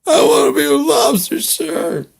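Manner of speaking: high, loud crying answer to the sergeant; wailing recruit. Acted. Recruit answering the sergeant, mock crying. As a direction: whimper like a terrified private.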